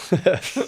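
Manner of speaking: high, loud burst of laughter; laughing at the rollercoaster of the business; rueful laugh. Real, not acted. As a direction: laugh at how absurd it got. Rueful laugh after story.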